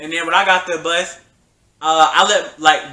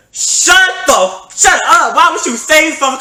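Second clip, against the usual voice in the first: higher and louder furious yell; angry shout; 9 semitones higher, 6 dB louder, slower than usual. Retold or imitated. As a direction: snap at her to shut up. Retold outburst at her.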